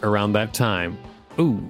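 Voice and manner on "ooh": ordinary talk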